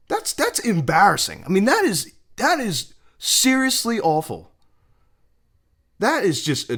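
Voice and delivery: loud, high-pitched indignation; outraged. Real, not acted. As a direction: condemn the call in loud, high disbelief. Condemning the umpire's call.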